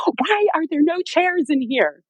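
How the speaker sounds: high, drawn-out mock wail of complaint; high-pitched exasperated plea, hammed up; retold scream. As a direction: act out crying out mid-contraction without full volume. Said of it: "scream out to my husband".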